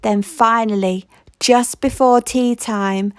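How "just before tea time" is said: ordinary delivery, nothing marked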